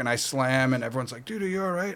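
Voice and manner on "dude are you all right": higher, softer imitation of a worried onlooker; raised-pitch, softened retold concern; concerned bystander. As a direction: mimic someone checking on a fallen friend. Bystanders checking on him after slam.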